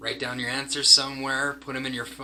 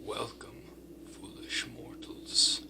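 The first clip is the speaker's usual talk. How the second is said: spooky whisper; 8 dB quieter than usual. Pretend. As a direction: whisper it like a haunted house host. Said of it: "Sorry if I scared you".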